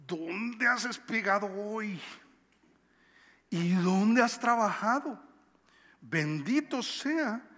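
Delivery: slow, amazed questions to Ruth; the mother-in-law marvelling, drawn out; mother-in-law asks Ruth in slow amazement; surprised. A narrator's reading.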